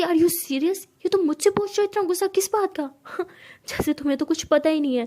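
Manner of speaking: incredulous anger. Acted. Siya scoffing at Tara's question. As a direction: throw the question back at her.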